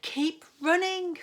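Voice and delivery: high, loud urgent call; Katy calling to her family. A narrator's reading. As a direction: urge them on loudly. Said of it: "called Katy".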